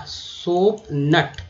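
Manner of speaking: सोप नट very slowly, "nut" with short a; slow pronunciation demo. Shown as a demonstration. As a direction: draw out सोप नट. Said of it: "यू का उच्चारण अ के रूप में". (सोप नट धीरे बोलें)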